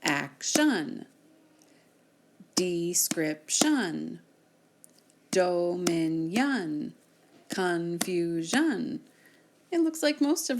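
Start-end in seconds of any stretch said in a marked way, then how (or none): 0.0-9.0 each word stretched very slowly, syllable by syllable; slow syllable reading. Shown as a demonstration. Teacher slowly sounds out syllables for the class. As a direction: read them slowly as if clapping each beat. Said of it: "two syllables".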